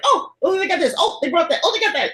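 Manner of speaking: high-pitched, delighted recognition; happy little bursts as each familiar name turns up; giddy fan reaction, pitched high; really happy. Retold or imitated. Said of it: "really happy".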